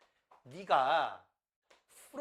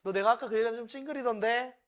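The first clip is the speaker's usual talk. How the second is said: higher, louder and fast, offhand; quick casual remark; 7 semitones higher, 7 dB louder, faster than usual. Shown as a demonstration. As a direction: toss it off lightly like a friend noticing. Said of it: "두 번째". (친구에게 가볍게 빠르게 던지듯)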